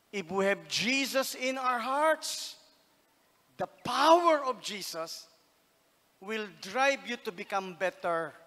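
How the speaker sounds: slow, loud, fervent proclamation; loud slow sermon peak; preaching climax. Acted. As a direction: build to a shout of praise.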